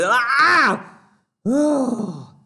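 high sudden cry of surprise; surprised yelp. Real, not acted. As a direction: yelp as the tongue slips. Trips over tongue twister.

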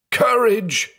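high, drawn shriek without much extra volume. A narrator's reading. Blind man to his sister in the coffin, shrieked. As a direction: shriek encouragement, pitched far up. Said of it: "he shrieked".

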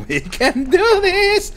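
slow, very high-pitched voice; playful pep talk. Pretend. Gamer psyching himself up with the jetpack, playful pep talk. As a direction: stretch it out high and squeaky.

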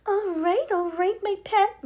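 calm the brat down, hammed-up; mild soothing. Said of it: "Missus Salt said soothingly".

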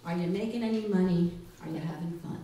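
ordinary talk, nothing marked

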